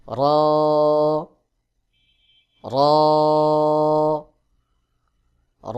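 র drawn out, tongue against the palate; letter demo. Shown as a demonstration. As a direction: sustain র as a full Arabic raa. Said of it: "তার বরাবর উপরের তালুর সঙ্গে". (জিহ্বার আগার পিঠ তালুতে লাগিয়ে টেনে র)

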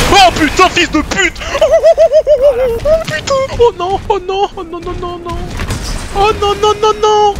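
high, frantic cries of panic; panicked cries. Real, not acted. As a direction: panic as the chase closes in. Panique en pleine poursuite. (cris de panique)

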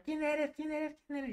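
high-pitched mock yelling; retold shouting. Group shouting at the initiate, rapid high mock yell. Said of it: "Te empiezan a gritar".

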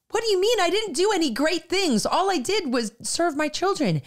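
a hint of a baffled mom brushing off praise; bewildered mother.

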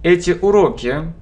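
normal talking voice

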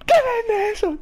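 high, loud get-in-there yell; roaring like a goal; celebratory shout. Real, not acted.

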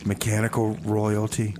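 usual speaking voice

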